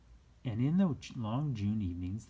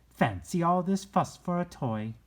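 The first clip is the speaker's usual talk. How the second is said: higher, louder, fussing nanny; grumbling Nana; 9 semitones higher, 7 dB louder than usual. A narrator's reading. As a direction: scold the fuss like a cross old nurse. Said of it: "she said".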